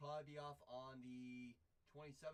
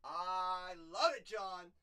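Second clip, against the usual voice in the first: much louder, slow, stretched out; loud delighted yell; 4 semitones higher, 15 dB louder, much slower than usual. Real, not acted. To John about his finger trick, delighted yell. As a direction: belt it out with delight. Reacting to viewer's finger gesture.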